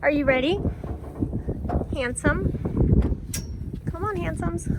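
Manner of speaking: high, loud cheerful pet voice; talking to a horse. Real, not acted. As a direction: coax the animals warmly along. Unloading horses, coaxing them home.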